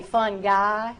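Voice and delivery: "fungi" as "fun-guy", hard G. Retold or imitated. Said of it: "So he'll ask me".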